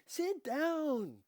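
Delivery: high, loud matriarch command; commanding Dika. A narrator's reading. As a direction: command them to sit. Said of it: "Dika commanded".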